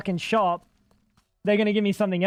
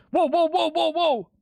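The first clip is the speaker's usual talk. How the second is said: a burst of high whoas; startled whoa; 9 semitones higher than usual. Real, not acted. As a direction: jump at what just happened. Startled by the game.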